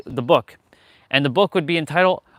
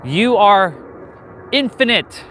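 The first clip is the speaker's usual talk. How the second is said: louder and higher, projected to a passer-by; raised voice telling a passing girl she is infinite; 4 semitones higher than usual. Retold or imitated. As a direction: call it out so a stranger hears. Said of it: "I said that out loud so she can hear".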